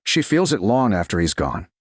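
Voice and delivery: usual speaking voice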